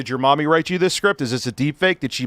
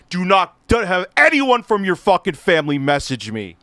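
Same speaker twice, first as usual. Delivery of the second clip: swearing tirade; 3 semitones higher than usual. Real, not acted. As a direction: explode with rage. Furious outburst.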